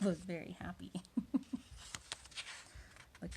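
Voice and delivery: ordinary delivery, nothing marked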